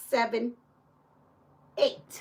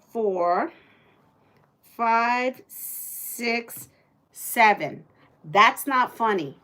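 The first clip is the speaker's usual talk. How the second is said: usual speaking voice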